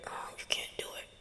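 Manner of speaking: fast, breathy little whisper; the doubt as a tiny bug's whisper. Retold or imitated. Negative voice whispering like a tiny bug, whisper. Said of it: "bugs are very tiny".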